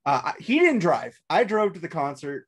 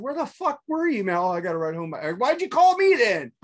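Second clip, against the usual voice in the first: exasperated yell; 5 semitones higher than usual. Retold or imitated. Friend confronting the drunk who stood him up, exasperated. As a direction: let him have it.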